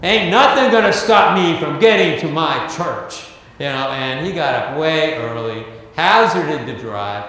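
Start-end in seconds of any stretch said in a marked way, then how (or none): 0.0-3.3 louder, higher, set on getting there; determined declaration. Retold or imitated. Student preacher vowing to reach his church, loudly determined. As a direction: plant your feet and declare you won't be stopped.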